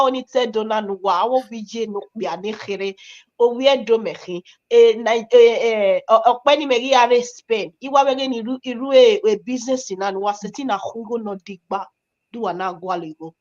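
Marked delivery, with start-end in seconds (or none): none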